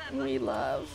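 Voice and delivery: "We love" dropped low with a weight on "we"; low deep. Shown as a demonstration. Deep low echo of her own line.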